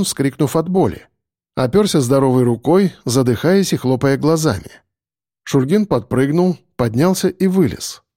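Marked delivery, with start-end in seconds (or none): none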